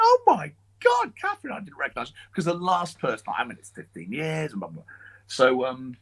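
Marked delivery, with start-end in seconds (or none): 0.0-2.2 put-on stunned at recognising an old friend; surprised gasp. Retelling meeting Catherine again, high astonished surprise.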